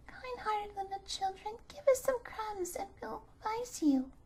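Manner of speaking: put-on little birds twittering advice to the children; chirping little birds. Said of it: "chirped to the children".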